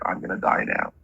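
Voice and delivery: tongue-in-cheek melodrama; mock dramatic despair. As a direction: play the teary kid who thinks a paper cut is fatal. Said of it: "she gets dramatic".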